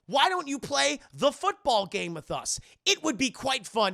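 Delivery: high, loud, over-emphatic invitation; creepy inviting character. Retold or imitated. Infected Usher luring Stan to play, exaggerated invitation. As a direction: push each phrase high and loud.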